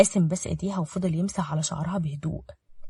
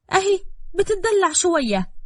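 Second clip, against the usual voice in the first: high, drawn-out mocking scorn; dismissive; 11 semitones higher, 4 dB louder, slower than usual. A narrator's reading. Girl belittling her cousin's illness, dismissive. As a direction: draw it out scornfully, pitched high.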